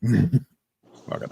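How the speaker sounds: ordinary talk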